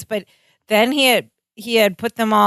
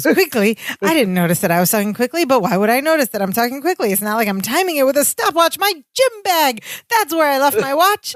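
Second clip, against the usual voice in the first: rapid babble pitched higher; fast manic rant; 4 semitones higher, faster than usual. Retold or imitated. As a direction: race through it, wired and scattered. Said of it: "he goes into the next rant".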